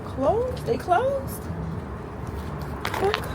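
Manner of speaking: dismayed surprise. Real, not acted. Finding the restaurant shut.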